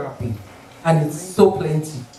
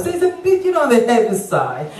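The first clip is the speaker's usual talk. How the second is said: high, delicate doctor's hedge; mock genteel doctor softening the blow; polite British doctor; 4 semitones higher than usual. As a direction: tiptoe round the bad news like a polite doctor. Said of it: "That's how they speak".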